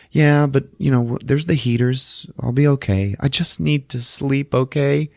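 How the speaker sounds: a hint of forced reassurance; fragile wife. Annie voiced to husband.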